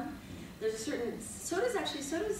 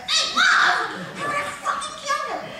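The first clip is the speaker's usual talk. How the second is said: much higher, much louder, yelling through laughter; furious laughing shout; 10 dB louder, slower than usual. Retold or imitated. Retelling the award-show brawl, laughing furious yell. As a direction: scream it, half laughing at yourself. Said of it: "I'm like".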